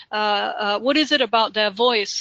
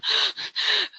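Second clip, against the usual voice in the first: sharp gasping breath in; audible nervous breath; 11 semitones higher than usual. Shown as a demonstration. Trainer showing how nervous people breathe, audible gasp. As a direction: gasp for air like a nervous speaker. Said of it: "you can even, f-, you know, hear them".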